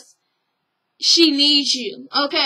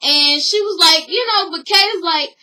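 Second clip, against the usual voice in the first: ordinary talk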